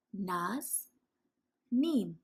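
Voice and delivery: name split into two chunks, Naz then Neem; syllable by syllable. Shown as a demonstration. Teacher showing pupils how to split a name into syllables. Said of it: "I would say".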